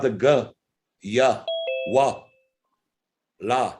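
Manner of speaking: "G, Y, W, La" as spoken syllables guh, yuh, wuh, la; soft consonant sounds. Shown as a demonstration. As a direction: sound out "G, Y, W, La" one by one with pauses. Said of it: "all of your soft consonants".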